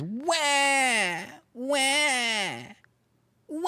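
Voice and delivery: high, loud wailing wah sounds, mocking a crybaby; hammed-up fake crying; mock baby crying. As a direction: whine like a bawling toddler to ridicule him. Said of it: "This guy is crying so hard".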